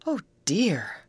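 understated fearful lament; frightened cry. A narrator's reading. Grace shrinking against her friend Betty, frightened cry. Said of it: "cried Grace as she shrank back".

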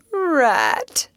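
high, mournful moan. Acted. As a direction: moan his name in despair. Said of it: "he moaned".

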